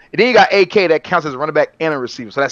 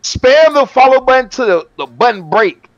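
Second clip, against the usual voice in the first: excited; 8 semitones higher, 6 dB louder than usual. Real, not acted. Hyping chat to spam follow.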